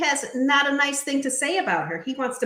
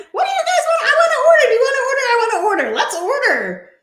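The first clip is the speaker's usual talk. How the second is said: much higher and louder, laughing shouts; over-the-top Shayna; 14 semitones higher, 9 dB louder, faster than usual. Retold or imitated. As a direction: squeal demands for food like an overexcited Shayna. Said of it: "then there's Shayna".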